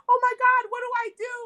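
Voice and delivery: high, panicky voice, lightly hammed-up; mock panic. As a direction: imitate a panicking woman over a snakebite. Julia Roberts panicking over snakebite.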